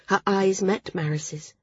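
ordinary delivery

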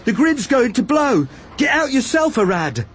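high, panicky warning, falling on the name; alarmed shout. A narrator's reading. Villager fleeing and warning Arad, alarmed shouting. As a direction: throw the warning back at him, high-pitched. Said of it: "one of the villagers shouted".

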